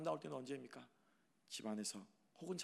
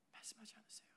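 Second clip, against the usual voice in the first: whisper; 8 semitones higher, 17 dB quieter than usual. Shown as a demonstration. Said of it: "말씀하지 않습니까?".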